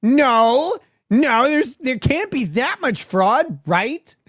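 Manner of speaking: loud, flustered denial that winds down into a doubtful question; mock-outraged protest fading to a whiny "right?"; mocking Destiny. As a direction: play the clueless guy who won't believe it, loudest on the first noes. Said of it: "He's like".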